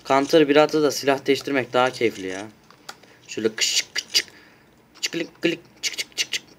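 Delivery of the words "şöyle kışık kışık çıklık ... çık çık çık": unvoiced clicking gunfire noises; imitating a weapon firing with mouth sounds; gun-sound imitation. Shown as a demonstration. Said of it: "yapıyor".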